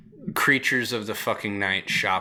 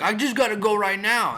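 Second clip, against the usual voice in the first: much higher, urgent pleading; desperate crackhead; 12 semitones higher, 5 dB louder than usual. Retold or imitated. Crackhead insisting to the worker he must go now, high desperate whine. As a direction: whine it like you're about to burst.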